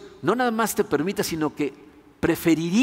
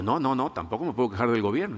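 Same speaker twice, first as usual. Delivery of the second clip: low, quick, lightly acted denial; guarded denial; 5 semitones lower, 4 dB quieter than usual. Cautious citizen asked about the government, mildly guarded denial. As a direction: keep it low and hurried, giving nothing away.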